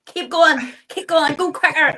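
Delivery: acted-out impatient coaxing; urging on. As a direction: egg yourself on, exasperated. Acting out urging herself in sparring.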